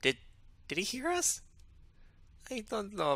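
very high, alarmed voice; frightened. Acted. Friends fear Russia overheard them, high frightened voice.

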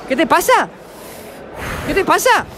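ordinary talk, nothing marked

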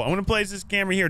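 usual speaking voice

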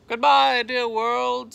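loud, high, mock-dramatic goodbye; tragic farewell. A narrator's reading. Tifky facing death, a hammed-up tragic goodbye. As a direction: bid the world a grand doomed farewell. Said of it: "said Tifky in his mind".

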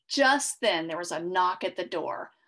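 ordinary delivery, nothing marked

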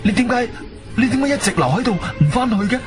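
high, hurried questioning; baffled, rushing the question out; urgent bewilderment. Acted. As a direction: press your friend for an answer. Urgently questions friend just found.

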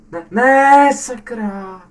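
high, loud yelp of dismay, then a curse; dismayed cry. Real, not acted. Player yelps as the character misses the jump, high and loud. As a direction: cry out as the jump goes wrong.

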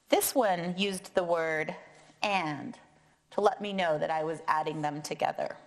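normal talking voice

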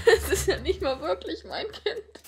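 breaks into a laugh while talking; giggly, incredulous aside; laughing in disbelief. Real, not acted. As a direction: laugh helplessly at your own situation. Laughs at the absurd situation.

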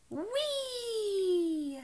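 squealed whee going down the slide; a delighted slide squeal; playful high whee. Pretend.

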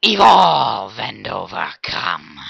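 slow, whispered monster voice; Kha'Zix. Retold or imitated. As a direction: whisper it like a hunting insect beast. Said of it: "I'm quoting Kha'Zix from League of Legends".

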